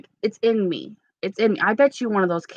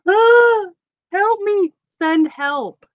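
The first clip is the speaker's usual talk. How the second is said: mock distress; 11 semitones higher, much slower than usual. Cat clawing her.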